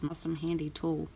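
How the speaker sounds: normal talking voice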